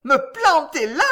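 high, loud, indignant repeat; enraged cry. A narrator's reading. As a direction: cry out in fury. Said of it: "cria-t-elle en tremblant de rage". (question criée avec rage)

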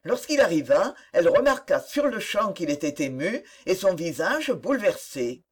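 usual speaking voice

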